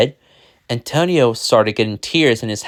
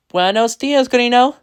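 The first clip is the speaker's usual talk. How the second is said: high, lilting greeting rising at the end; wife's reply; 11 semitones higher, 4 dB louder than usual. A narrator's reading. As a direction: answer the husband, voice climbing upward. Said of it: "she replied".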